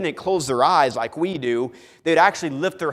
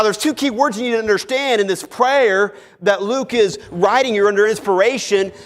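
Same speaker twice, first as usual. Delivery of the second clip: ordinary talk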